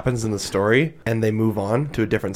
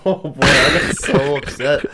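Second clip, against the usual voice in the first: louder, voice rising upset; voice going up; 3 semitones higher, 5 dB louder than usual. Real, not acted. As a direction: let the voice jump up with real upset. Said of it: "My voice went up".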